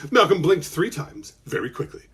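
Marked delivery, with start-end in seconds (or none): none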